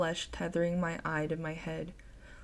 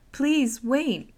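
loud, high plea; calling out; 7 semitones higher, 6 dB louder than usual. Acted. Narrator calling after the fleeing head, pleading loudly. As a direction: call out after it, begging. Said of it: "I call out to it".

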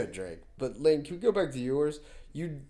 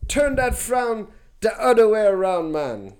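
high, loud Jamaican opening; hammed-up Jamaican; Jamaican accent; 5 semitones higher, 6 dB louder than usual. Shown as a demonstration. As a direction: cheer someone up in a put-on Jamaican lilt. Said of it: "do a good Jamaican one".

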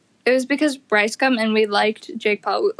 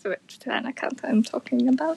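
whisper; 5 dB quieter than usual. Real, not acted. Whispered aside about the account.

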